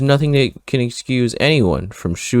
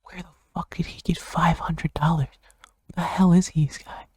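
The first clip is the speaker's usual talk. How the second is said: incredulous whisper; 5 semitones higher, 6 dB quieter than usual. Real, not acted. Incredulous aside about the money.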